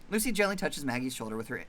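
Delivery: ordinary delivery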